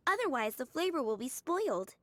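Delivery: ordinary talk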